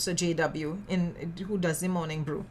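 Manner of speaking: ordinary talk, nothing marked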